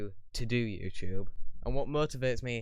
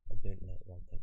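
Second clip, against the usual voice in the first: a light Scottish accent on 'I don't like writing'; 3 semitones lower, faster than usual. Shown as a demonstration. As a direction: try the line again in a mild Scottish accent. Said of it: "that's definitely Scottish".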